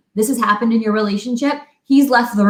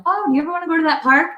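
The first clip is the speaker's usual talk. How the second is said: quick, higher-pitched eager chatter; chirpy question; 6 semitones higher, much faster than usual. Retold or imitated. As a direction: bubble over with a passing idea. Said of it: "I'm like".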